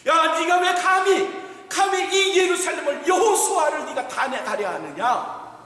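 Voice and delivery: God scolding Satan, shouting. Retold or imitated. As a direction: tear into the accuser. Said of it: "하나님이 꾸짖으시네요".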